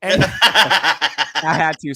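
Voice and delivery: words breaking up in laughter; laughing. Real, not acted. Speaker excusing his crude joke, laughing. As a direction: get it out through loud laughter.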